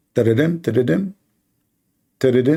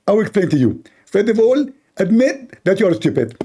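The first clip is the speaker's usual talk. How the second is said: high, smug preacher voice; Zakir Naik impression; 7 semitones higher than usual. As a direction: preen like a self-important cleric. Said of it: "So Zakir".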